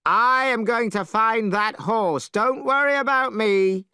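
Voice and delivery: Monkey heading out to hunt; bold Monkey. Acted. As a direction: set off full of swagger.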